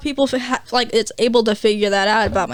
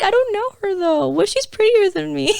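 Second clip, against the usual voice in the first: insecure whine; 8 semitones higher than usual. Retold or imitated. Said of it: "I was like".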